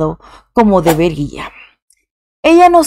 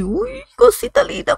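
cooing mimosa voice; 13 semitones higher, 5 dB quieter than usual. Real, not acted. Vlogger gushing over the young singer, cooing. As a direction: coo over her like a cute little thing. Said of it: "me pongo así como, como mimosa".

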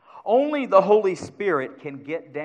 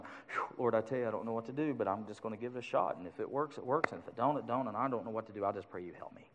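much softer, half-whispered mock prayer; lazy faithless prayer; 6 semitones lower, 12 dB quieter, faster than usual. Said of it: "going to the Lord and going".

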